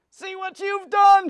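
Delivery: high, loud, slow shriek; witch screaming. Acted. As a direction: scream it at the girl in horror. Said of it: "she screamed".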